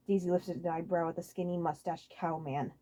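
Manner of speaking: ordinary delivery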